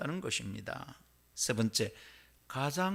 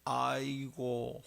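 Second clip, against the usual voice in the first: slow drawn-out 아이고 groan; long weary 아이고 sigh; sighing aigo; much slower than usual. Shown as a demonstration. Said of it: "뭐라 합니까".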